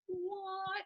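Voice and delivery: high, hushed disbelief; stunned what. Retold or imitated. Friend reacting to the stunt video, high hushed disbelief. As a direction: breathe it out in amazement. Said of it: "I was like".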